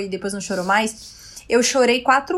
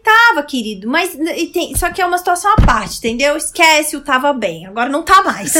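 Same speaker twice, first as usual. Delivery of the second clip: loud, patronising "querido" lecture; condescending explaining; 4 semitones higher, 7 dB louder than usual. Retold or imitated. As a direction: explain it to him like a child, loud. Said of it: "Eu falei". (explicando com condescendência, em tom alto)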